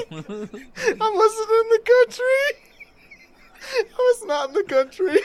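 high, laughing, nearly crying. Real, not acted. Laughing as he admits where it happened. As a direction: laugh through the words in disbelief. Laughing while telling shameful story.

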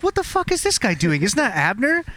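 high, incredulous yelp of disbelief; shocked outrage. Retold or imitated. Soldiers spot the enemy Abner and are shocked: high, startled outrage. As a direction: squeal it like you can't believe your eyes.

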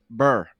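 "burr" as one short r-coloured syllable; shortened to BURR. Shown as a demonstration. Showing the young generation's version, "burr" with an r-coloured vowel. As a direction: cut it down to "burr", rhyming with fur. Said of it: "They, they've shortened it even".

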